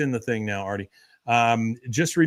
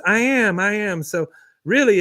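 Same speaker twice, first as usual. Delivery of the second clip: mock proud, high; 7 semitones higher, 3 dB louder than usual. Retelling Luann's proud agreement.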